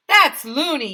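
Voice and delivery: loud, high outraged yell; yelling nurse. A narrator's reading. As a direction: shout the protest. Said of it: "yelled Missus Cooney".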